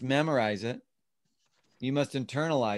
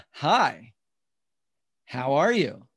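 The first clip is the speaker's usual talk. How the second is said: a livelier, more personal hi how are you; different tone, internalized; 4 dB louder, much slower than usual. Shown as a demonstration. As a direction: say it like you really mean it. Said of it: "I said it in a different tone".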